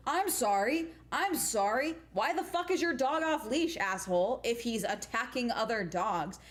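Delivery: indignant confrontation. Retold or imitated. Walker confronting the owner of an off-leash dog, re-enacted indignation. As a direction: replay an incredulous telling-off.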